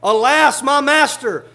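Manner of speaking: loud, high alarmed cry of the servant; panicked cry. Acted. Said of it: "it says with an exclamation point".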